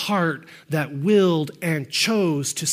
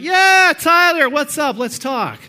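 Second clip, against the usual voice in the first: much higher, louder, falsely breezy hello that fades out; mock cheerful greeting; 8 semitones higher, 6 dB louder, slower than usual. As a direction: ham up a sarcastic chummy welcome. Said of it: "Am I gonna be like".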